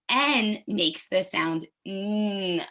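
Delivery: "N" said slowly as a hummed "nnn" through the nose; letter sound demo. Shown as a demonstration. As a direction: model a slow hummed "N" sound. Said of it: "Look at my lips".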